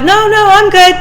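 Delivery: high, carefree mock reassurance; breezy friend. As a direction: toss it off like nothing is wrong. Friend brushing off worry.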